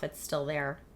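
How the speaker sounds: tired, scratchy voice; worn-out tired voice. Real, not acted. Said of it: "my tired voice".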